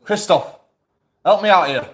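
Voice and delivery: loud high call for help. Real, not acted. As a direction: shout across the house for help. Calling for help from upstairs.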